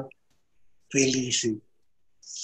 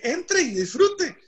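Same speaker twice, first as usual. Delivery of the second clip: high, drawn-out welcome; exuberant invitation; much slower than usual. Retold or imitated. San Pedro letting the paisa into heaven, exuberant. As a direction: usher them in, pitched way up.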